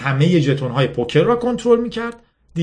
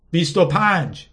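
ordinary delivery

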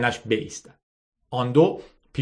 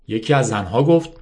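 usual speaking voice

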